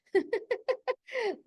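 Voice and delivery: a quick high burst of laughter; bright high laugh. Real, not acted. Laughs after pride line.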